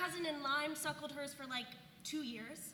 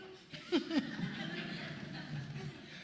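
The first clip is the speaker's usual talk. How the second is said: laugh; 3 semitones higher than usual. Real, not acted. Laughing at own retort.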